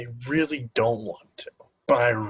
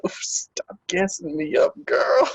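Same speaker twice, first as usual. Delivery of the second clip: higher, lightly flattered protest; playful mock protest; 8 semitones higher than usual. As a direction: playfully wave off a compliment. Retells playfully protesting a compliment.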